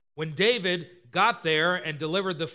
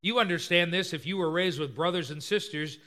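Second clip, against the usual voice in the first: ordinary talk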